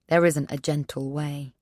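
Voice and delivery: ordinary delivery, nothing marked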